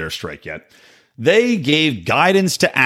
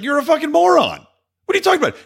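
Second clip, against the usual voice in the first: very high-pitched, incredulous comeback; exasperated retort; faster than usual. Retold or imitated. Host replying to the actor's tweet, exasperated high voice. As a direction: shoot back in a squeaky, exasperated voice. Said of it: "I replied, I was like".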